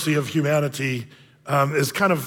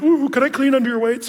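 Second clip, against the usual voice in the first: higher, lightly voiced doofy little guy; doofy janitor voice; 7 semitones higher than usual. Janitor innocently asking the lifters, mock doofy voice. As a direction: play dumb and harmless, pitched up. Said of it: "he talks like this".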